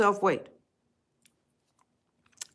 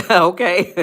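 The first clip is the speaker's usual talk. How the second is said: laughing okay; 2 semitones higher, 5 dB louder than usual. Real, not acted. Laughs at comments.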